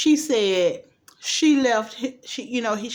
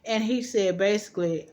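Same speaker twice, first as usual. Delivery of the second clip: ordinary talk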